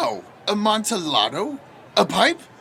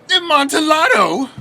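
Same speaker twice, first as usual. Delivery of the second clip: eager cry; 11 semitones higher than usual. Acted. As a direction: call out the name hungry for it. Fortunato excited by the wine.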